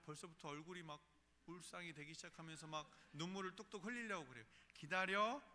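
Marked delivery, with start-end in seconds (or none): 4.7-5.4 firm command. Retold or imitated.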